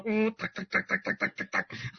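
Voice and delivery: rapid tak tak tak gun noise through laughter; laughing gun-rattle noise; imitated machine gun rattle. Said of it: "all you'd hear is the".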